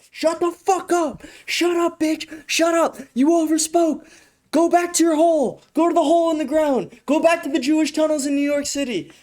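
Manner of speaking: a frantic handler squeaking commands off camera; mock camera handler. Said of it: "They were like".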